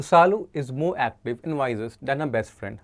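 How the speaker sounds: slow dictation of the question; slow question read. Shown as a demonstration. Teacher reading the exam question aloud, slowly. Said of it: "पहला question अगर हम देखें".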